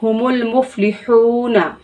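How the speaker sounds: हुमुल मुफलिहूना with a throat हा and long हू; slow, throat ha and stretched हू; careful recitation of मुफलिहूना; throaty drawn ha. Shown as a demonstration. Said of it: "तो हम इसको थोड़ा सा खींच कर पढ़ेंगे".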